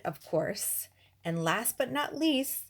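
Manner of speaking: ordinary talk, nothing marked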